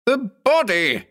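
high, drawn-out frantic cry; shouted. A narrator's reading. Ed to uncomprehending onlookers about the body, shouting. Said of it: "Ed shouted".

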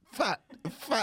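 "Fat" spat out, high and hard; violent "fat". Retold or imitated. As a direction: punch "fat" like an insult. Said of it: "Why were you saying fat with so much violence".

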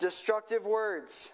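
slow, lifted prayer line for repeating; slow led prayer. Shown as a demonstration. Pastor leading the congregation in a slow call-and-repeat prayer. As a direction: pray it slowly so they can echo. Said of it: "just repeat after me".

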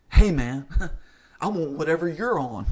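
loud, laughing mock of a wisecracking guy; mocking a joker. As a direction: imitate the guy who thinks he's hilarious. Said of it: "they think they're so funny when they say this".

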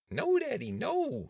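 put-on very high, loud little-girl squeal. A narrator's reading. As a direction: squeal in playful protest, pitch way up. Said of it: "she squealed".

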